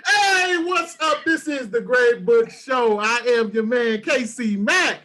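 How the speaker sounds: high, hyped podcast host greeting; show intro. Acted. Host greets listeners to The Great Book Show, hyped intro. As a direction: open the show big and drawn out.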